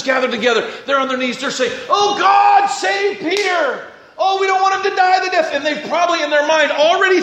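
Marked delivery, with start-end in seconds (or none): 1.9-5.5 high wailing cry to God; desperate prayer. Acted. Church on their knees begging God to save Peter, high wailing plea.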